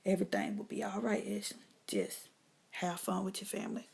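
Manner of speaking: soft and hushed, a calm inner voice; God's quiet voice. Retold or imitated. God reassuring Ashley before the family reunion, hushed. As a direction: speak gently, like a voice from above. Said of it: "I could hear God say".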